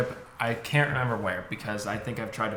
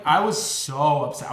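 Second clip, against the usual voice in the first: ordinary delivery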